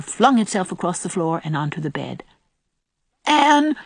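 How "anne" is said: high, loud mock-sobbed cry; sobbing. A narrator's reading. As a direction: cry out her name like an upset child. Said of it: "sobbed Davy".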